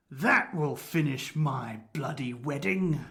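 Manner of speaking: low, slow put-on rustic accent; Tom Otter. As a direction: draw out a villager's accent, low and deliberate. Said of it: "now Dunkerley's doing Tom Otter's accent".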